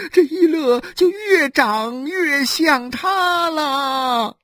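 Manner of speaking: tearful lament. Acted. Wife laments her fate on the doorstep, sobbing. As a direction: wail it out, drawing out the endings. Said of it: "哭诉着".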